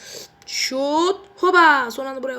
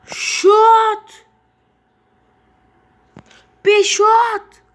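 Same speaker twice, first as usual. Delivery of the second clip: excited shout; 7 semitones higher, 11 dB louder, much slower than usual. Real, not acted. Gamer shooting at goal.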